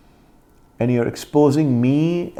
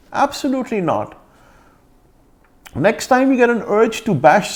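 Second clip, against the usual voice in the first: pitched up high, indignant and scornful; real exasperated outrage, raised high; heated indignation; 8 semitones higher than usual. Real, not acted. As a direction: tell her she's a nobody, voice climbing high. Angry rant at critic peaks.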